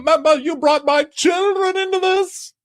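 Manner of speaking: high, loud hammed-up yell; screaming Housewife parody; mock shout. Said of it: "would be like".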